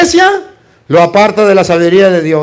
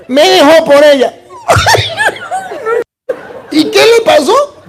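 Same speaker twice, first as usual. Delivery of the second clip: shrieking laughter through the words; laughing; 13 semitones higher than usual. Retold or imitated. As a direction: laugh high as you retell it. Laughing retelling the woman.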